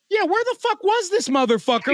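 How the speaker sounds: high-pitched, incredulous demand; incredulous outburst. Retold or imitated. Reader reacting to Varys showing up, incredulous outburst. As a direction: re-enact being baffled, voice jumping up. Retells own stunned reaction while reading.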